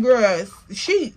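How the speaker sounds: ordinary talk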